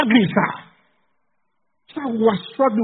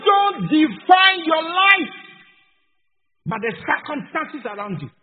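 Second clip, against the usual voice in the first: full-force preaching; 4 semitones higher, 4 dB louder than usual. Acted. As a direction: drive it home from the pulpit.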